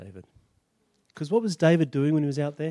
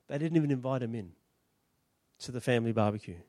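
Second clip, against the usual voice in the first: lower, softer and faster, almost thrown away; dropped low aside; 8 semitones lower, 10 dB quieter, faster than usual. Shown as a demonstration. Preacher to congregation, a soft throwaway repeat. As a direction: let the words fall low and quick.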